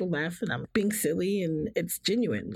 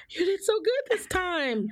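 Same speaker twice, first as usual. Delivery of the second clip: high, mock-proud praise; imitating proud sister; 12 semitones higher than usual. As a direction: cheer her on like a proud sister. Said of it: "She's like".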